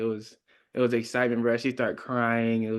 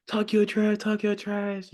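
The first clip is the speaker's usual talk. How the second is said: higher, egging-on chant; hyped-up teammates; 8 semitones higher, slower than usual. Retold or imitated. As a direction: pump him up like the guys on the sideline.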